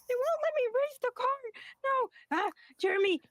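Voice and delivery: high-pitched squealing badger children; shrill kids fighting over racing the cart; excited little badger kids bickering; squabbling kids.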